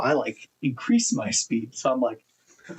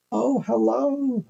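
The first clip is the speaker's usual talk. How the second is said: put-on high, slow little old lady greeting; high, drawn-out granny hello; sweet old lady; 12 semitones higher, much slower than usual. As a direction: lilt it up like a tiny old shopkeeper. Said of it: "She goes".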